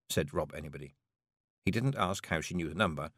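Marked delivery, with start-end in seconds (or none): none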